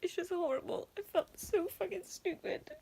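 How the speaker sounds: fighting back tears; real upset, on the edge of crying; tearful. Real, not acted. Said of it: "I know I'm getting fucking emotional".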